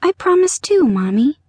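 put-on high, meek little-girl voice. A narrator's reading. Little girl to her mother, with trembling lip, meek. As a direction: promise timidly as a small child. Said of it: "the little girl said meekly".

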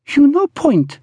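put-on high, pouty accented woman's voice; pouting. A narrator's reading. Pearl brushing Brigg's hand aside, pouting. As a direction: pout it out, pitched high. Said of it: "she pouted".

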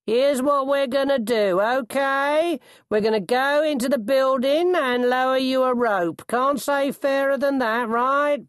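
high, menacingly cheery hail; cheery bellow. A narrator's reading. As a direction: call up with the wrong kind of cheer. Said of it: "a cheery voice bellowed".

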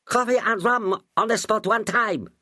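lightly voiced pidgin cook, high; a hint of a Chinese cook calling out; Chinese cook's cry. A narrator's reading. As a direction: narrator voicing the cook answering the order. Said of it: "cried the Chinese cook".

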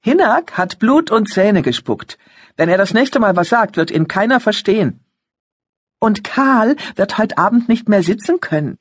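put-on high, cocky boasting teen; boastful boy. A narrator's reading. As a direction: brag like a proud teenager. Sons boasting.

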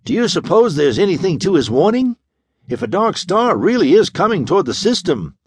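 put-on young operator, keyed up, asking quickly; a touch of nervous excitement; excited. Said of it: "looked excitedly up at the mate".

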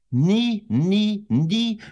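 NIE hit three times, high and loud; emphatic denial. A narrator's reading. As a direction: punch each NIE separately on a high pitch. Boy's emphatic inner refusal.

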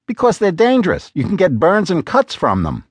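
a hint of an exasperated wife pressing her husband; Lena's voice. A narrator's reading.

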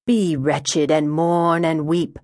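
slow, stretched-out preacher's intoning; solemn, drawn-out pulpit cadence; intoned scripture. A narrator's reading. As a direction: intone it like a commandment. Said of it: "Wyoming intoned".

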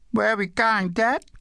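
put-on high-pitched child questioning Dad; little girl. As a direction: ask high like a small girl. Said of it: "The little girl came running after him".

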